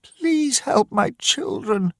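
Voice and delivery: high, slow, pleading cry; a desperate mother begging a man to save her children; crying plea. Acted. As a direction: beg through tears for the children. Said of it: "cried Missus Dawson".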